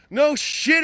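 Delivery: loud, high scoff; sarcastic no shit. Real, not acted. Mocking social media doom posts, loud sarcastic scoff. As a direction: bark it out sarcastically.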